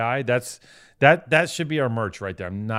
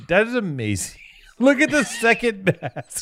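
high, laughing through the words; 11 semitones higher, 3 dB louder than usual. Real, not acted. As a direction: crack up while talking. Laughing hard at drawing.

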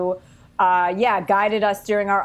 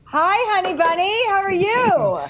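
playful high greeting; 10 semitones higher than usual. Real, not acted. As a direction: greet him high and teasing.

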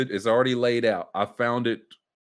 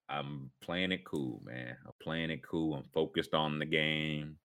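low and much softer, slow, playing it cool; a low, laid-back voice much softer than usual; low cool mumble; 6 semitones lower, 11 dB quieter, slower than usual. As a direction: murmur it low like a guy acting unbothered. George's cool reply voiced.

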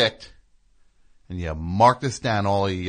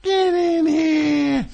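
loud call; 8 dB louder, much slower than usual. Acted. Calling Mike into the room.

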